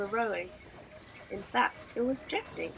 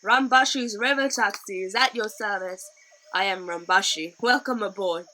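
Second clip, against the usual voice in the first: loud, swaggering host welcome; proud Rambashi; 9 dB louder than usual. A narrator's reading. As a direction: sell the boat with pride. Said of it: "he said proudly".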